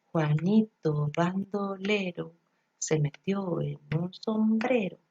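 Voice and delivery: slow, sing-song beat on "Juanito bandolero"; rhythmic rhyme. Shown as a demonstration. Demonstrating a rhyme's rhythm for preschoolers: slow sing-song. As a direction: chant it like a nursery rhyme, leaning on "bandolero" and "sombrero". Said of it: "tiene una entonación, un ritmo".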